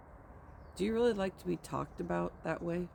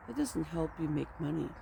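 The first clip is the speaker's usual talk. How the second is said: normal talking voice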